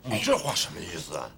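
ordinary delivery

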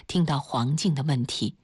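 plain reading voice

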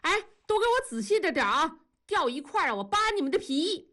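high, fast yelling orders; a mock threat shouted across the room; wife bossing the movers in a high fast yell; bossy shout. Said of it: "并且喊着说".

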